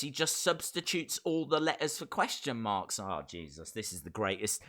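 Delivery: ordinary talk, nothing marked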